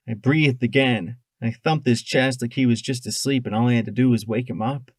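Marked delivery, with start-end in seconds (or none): none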